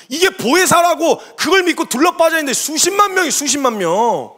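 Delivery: high, loud, indignant rant. Retold or imitated. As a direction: re-enact railing at the congregation. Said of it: "막 난리를 쳤어요". (격분해서 따지는 호통)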